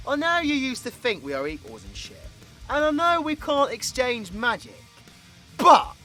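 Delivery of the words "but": sudden shout. Acted. Sudden attack during the fight.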